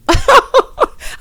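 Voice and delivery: loud, high burst of laughter; high, genuine cackle; real laugh. Real, not acted. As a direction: crack up at your own bluntness. Laughs at own blunt admission.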